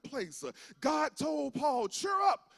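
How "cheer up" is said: higher, upbeat quoted call; cheering call. Retold or imitated. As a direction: call it out high to lift him up. God's call to Paul quoted.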